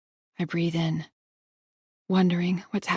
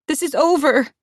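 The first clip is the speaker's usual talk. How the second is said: high, loud furious yell; angry shout; 8 semitones higher, 7 dB louder than usual. Acted. Heroine shouting at Nicole, furious. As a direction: shout it to end everything. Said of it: "I shout".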